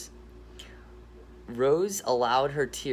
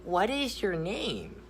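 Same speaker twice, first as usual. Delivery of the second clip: timid gator question; 7 semitones higher than usual. Acted. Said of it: "ask the rock a question".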